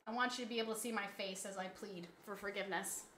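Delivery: soft, fast mumble; low-key rushed plea; quiet, quick aside. Real, not acted. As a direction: murmur it while moving closer. Leaning into the camera to plead.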